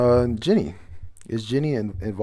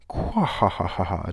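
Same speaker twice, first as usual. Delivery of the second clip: read-out stagey cackle; hammed-up laugh in separate syllables; deliberately fake, boastful character laugh; mock villain laugh; 2 semitones lower than usual. Reads a character's written laugh.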